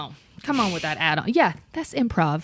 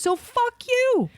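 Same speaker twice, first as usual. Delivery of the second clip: mock defiance; much slower than usual. Scorning the customer.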